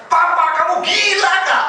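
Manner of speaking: wife voiced high and loud, slow; a disbelieving wife scolding her husband; startled wife. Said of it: "Istri saya". (istri yang kaget dan tak percaya, nada tinggi dan keras)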